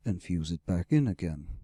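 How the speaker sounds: low interrupting dragon, anger held under; growling, simmering anger. Acted. Dragon interrupts his rescuer's explanation, growling with simmering anger. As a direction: cut in low, anger simmering beneath the words. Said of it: "anger was simmering beneath growling words".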